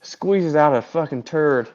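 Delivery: usual speaking voice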